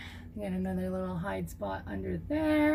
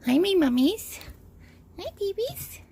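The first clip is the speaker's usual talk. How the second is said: high cooing pet talk; 12 semitones higher than usual. Real, not acted. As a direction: coo sweetly to the lizards. Greeting her bearded dragons.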